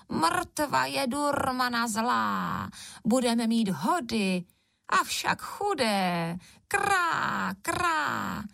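high, drawn-out crow croak; cawing crow voice. A narrator's reading. Two crows foretell doom overhead, high cawing voice. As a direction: speak as a cawing crow, stretching the vowels. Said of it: "letěly dvě vrány".

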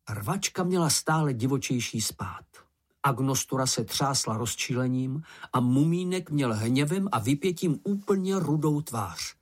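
ordinary delivery

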